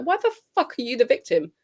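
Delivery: scandalised, rising to a high, loud pitch; mock outrage. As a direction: fire back in hammed-up disbelief. Acted retort to the athlete's statement.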